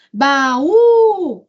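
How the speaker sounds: "Baú" com o U alongado e mais agudo; tônica no U. Shown as a demonstration. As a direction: estique e destaque o U final de "Baú". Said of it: "sílaba tônica tá onde?". ("Baú" com ênfase forte na última sílaba, U)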